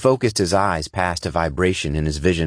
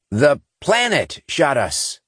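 slow, high, disgusted voice; 8 semitones higher, slower than usual. Acted. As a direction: spit it out in disgust. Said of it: "Matthews said in disgust".

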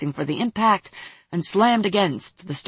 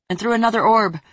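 ordinary delivery

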